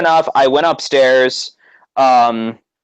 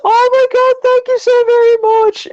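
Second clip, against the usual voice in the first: very high mock Irish accent; 3 dB louder than usual. Wrestler thanking a fan, high Irish accent. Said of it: "in her amazing friggin' Irish accent".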